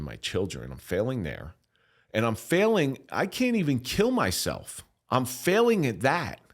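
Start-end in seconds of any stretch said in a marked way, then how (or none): 2.1-6.4 real pain pushing through, higher and louder, slower; anguished outburst. Real, not acted. Despair over his failures breaks out.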